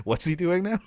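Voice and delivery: incredulous chuckle of confusion; amused, baffled question; laughing disbelief. Retold or imitated. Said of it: "I'm like".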